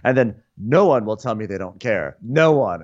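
normal talking voice

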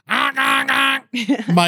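honking like a goose; goose impression. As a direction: honk it out like a goose. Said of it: "that's my goose impression".

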